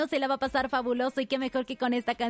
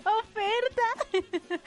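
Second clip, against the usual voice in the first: high, very drawn-out exclamation; gleeful echo; 7 semitones higher, much slower than usual. Real, not acted. Host echoes a caller's joke punchline, gleeful high drawn-out cry. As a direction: stretch it out high, relishing the punchline.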